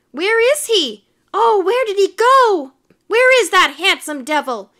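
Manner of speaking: high, loud searching wail; dismayed squealing admirers; crying out. A narrator's reading. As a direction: call out for the vanished sweetheart. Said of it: "cried the ladies".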